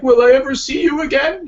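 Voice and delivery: high, plaintive falsetto question; painting's plaintive voice. Retold or imitated. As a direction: ask it like a pining lover. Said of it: "The painting's like".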